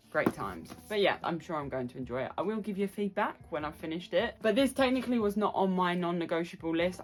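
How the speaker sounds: ordinary talk, nothing marked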